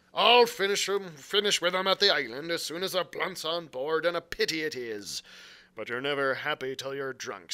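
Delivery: loud gruff pirate scolding; scornful pirate. Acted. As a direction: berate them like a pirate chief. Silver scolding the crew.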